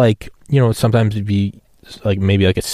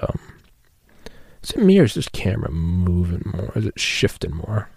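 usual speaking voice

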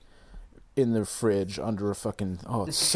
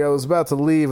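usual speaking voice

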